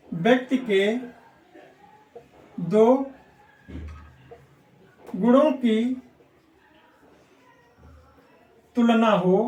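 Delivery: word by word, long pauses between words; slow dictation. Shown as a demonstration. Teacher dictates the rule for students to note, slowly word by word. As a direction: dictate so the class can write it down. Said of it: "तो note कर लो".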